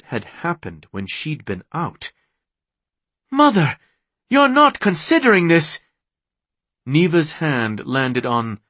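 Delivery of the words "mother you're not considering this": high, loud, alarmed objection; indignant protest. A narrator's reading. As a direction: protest in a much higher, louder voice. Daughter objects to her mother.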